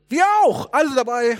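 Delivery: high, loud shout of joining in; acted gleeful group shout; eager chiming in. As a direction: pipe up loudly like a keen crowd. Disciples eagerly join Peter, all shouting.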